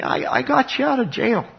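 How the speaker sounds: high-pitched informal paraphrase of God; voicing God. God reminds his people of their rescue, high-pitched imitation. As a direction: play God making a casual point, pitched well up. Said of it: "God was saying".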